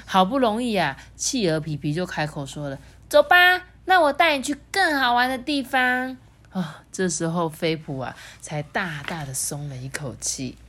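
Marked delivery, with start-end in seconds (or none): 3.1-6.1 high, perky penguin voice; eager penguin. A narrator's reading. Penguin leads friend to somewhere more fun, eager. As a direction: call your friend along brightly, pitched high.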